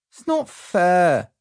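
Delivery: put-on high, slow childish whimper. A narrator's reading. Barry whimpers to his parents, whimpering. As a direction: whine it out sulkily. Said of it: "I whimpered".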